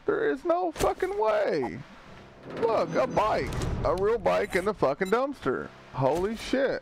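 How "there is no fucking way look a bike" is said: stunned disbelief. Real, not acted. Streamer stunned by dumpster bike.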